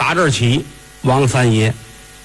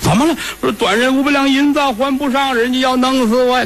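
higher, hammed-up fake crying; mock wailing madam; 10 semitones higher than usual. Madam fake-crying to the young master for money, high whining. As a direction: whine it like a staged sob story. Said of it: "老鸨坐楼道哭".

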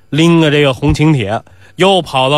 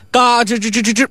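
creaking gate; 8 semitones higher than usual. Retold or imitated. Narrator imitates the gate opening.

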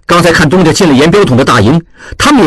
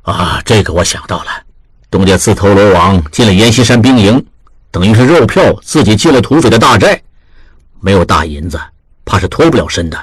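lower, steady voice of a senior manager giving orders; lower, serious old boss; grave elder master; 6 semitones lower than usual. A narrator's reading. Master weighs the rescue.

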